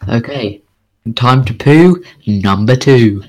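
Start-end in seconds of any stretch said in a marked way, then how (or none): none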